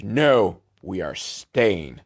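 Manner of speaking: stubborn and menacing, drawn out; evil wheelchair guy. Retold or imitated. Wheelchair guy refusing to leave the island, slow and menacing. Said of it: "the evil wheelchair guy's like".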